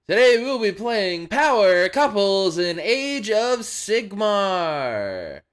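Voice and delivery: hyped-up announcer voice. Pretend. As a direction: announce it like a big event.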